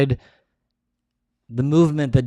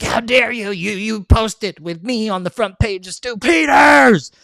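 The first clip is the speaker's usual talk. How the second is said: imitating an angry friend; 8 semitones higher than usual. Matt Baker scolding the speaker, high mock shout. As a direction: play the offended friend yelling at you. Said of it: "He's like".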